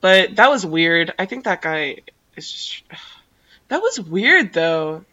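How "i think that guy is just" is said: a hushed, breathy aside with a sigh; whispering. Real, not acted. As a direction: drop to a whisper, as if he might overhear. Whispered aside about the guy.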